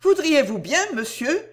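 high, urgent polite request; earnest heroine, urgent request. Acted. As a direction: ask a pressing favour, voice lifted. Narrator voices Cécile requesting.

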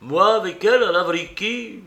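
high, loud incredulous question; astonished, pitch leaping up; taken aback. Acted. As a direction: echo it back in startled disbelief.

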